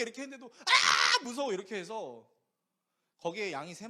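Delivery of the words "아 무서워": put-on loud child's cry of fear; scared shout. Son still screaming at the sheep, loud scared cry. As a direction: yell it like a frightened kid.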